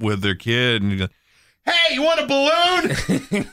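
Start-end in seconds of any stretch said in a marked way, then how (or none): 1.6-3.4 high, slow friendly yell; shouting. Retold or imitated. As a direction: yell it at a child. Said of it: "That's just you shouting".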